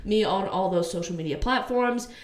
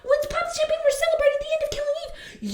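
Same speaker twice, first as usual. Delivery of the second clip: mocking celebration voice. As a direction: put on a smug, gushing party voice to ridicule them. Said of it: "and they're like".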